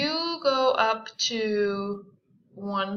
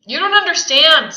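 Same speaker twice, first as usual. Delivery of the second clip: loud, higher, rising panic; touch of panic; 8 semitones higher, 14 dB louder than usual. A narrator's reading. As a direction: blurt it out with sudden panic. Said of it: "with a touch of panic".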